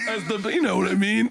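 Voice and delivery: high wheeze breaking into words; laughing. Real, not acted. Comic reliving a favorite quote, laughing. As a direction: talk through a fresh burst of laughter.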